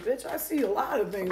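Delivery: sassy cat voice. Retold or imitated. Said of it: "Cat's like".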